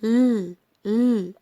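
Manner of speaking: ل held as a long "lll"; sound of L. Shown as a demonstration. Teacher showing how L is pronounced, held sound. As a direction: sustain ل alone, without a vowel. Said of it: "ال ال بتنطق".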